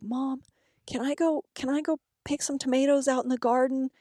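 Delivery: high-pitched imitation of a child asking; pleading daughter. Hungry daughter asking mom for garden tomatoes, imitated pleading. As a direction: pitch it up like a little girl.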